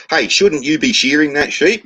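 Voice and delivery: high-pitched hail across a fence; blonde calling over. Acted. Blonde calls over fence in joke.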